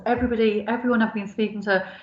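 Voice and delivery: ordinary delivery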